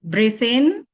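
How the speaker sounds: loud "Breathe in" rising on "in"; inhale cue. Shown as a demonstration. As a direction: lift and swell "in" to cue the breath. Cueing the group's inhale.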